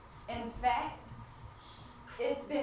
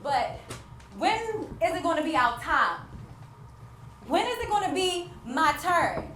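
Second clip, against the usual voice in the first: loud and high, each word pushed out; louder desperate plea building to every single one; anguished outcry; 4 semitones higher, 7 dB louder than usual. Real, not acted. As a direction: cry out the unfairness. Anguished outburst about her turn.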